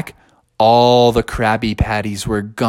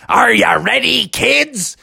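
high, hearty pirate call; rally the kids like a pirate; cartoon pirate; 9 semitones higher, slower than usual. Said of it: "a big pirate face popped up".

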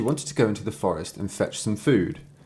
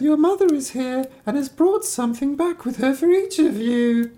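very high, sweet falsetto mummy voice; absurdly high fake-mother falsetto; chalk-softened wolf; 3 dB louder than usual. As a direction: squeak it like a wolf posing as mother. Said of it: "it made his voice soft".

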